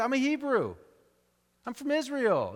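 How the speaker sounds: a hint of chest-out national pride; proud patriot. Jonah tells the sailors where he is from, a touch boastful. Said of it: "as he tells the sailors".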